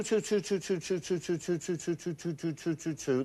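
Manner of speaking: soft rhythmic train chug imitation; train noise. Shown as a demonstration. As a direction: chug it out softly like a train on tracks. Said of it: "That's train noise".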